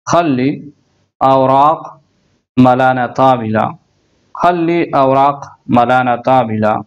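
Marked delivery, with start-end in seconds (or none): 0.1-3.8 each word said slowly apart with pauses. Shown as a demonstration. Teacher spelling out an Arabic sentence word by word for learners. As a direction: break the sentence into single words.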